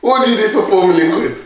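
high-pitched mock questioning; imitated colleague. Guy asking Jeff about four million quid, imitated. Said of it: "he goes".

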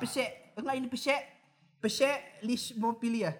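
pse- repeated, then pselismopilia slowly; stumbling pronunciation. Shown as a demonstration. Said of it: "P S E apa tuh". (tersandung di pse- lalu pselismopilia pelan)

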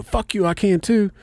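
high, sharp mock comeback; defiant retort. Retelling the fight with his buddy over leaving him, defiant. Retold quarrel in the van.

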